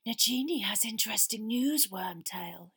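cold, whispery villain; breathy menace in a cold master's voice. A narrator's reading. Cold master giving news to his servant Wormtail, cold whispery voice. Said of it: "the cold voice slipped abruptly to English".

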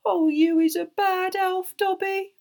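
slow, high elf wail; drawn-out high moan. A narrator's reading. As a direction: moan it reproachfully in a squeaky voice. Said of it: "moaned Winky".

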